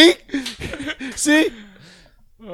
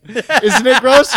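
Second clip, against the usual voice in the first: laughing; 3 semitones higher, 6 dB louder, much slower than usual. Real, not acted. Laughing at the gross word game.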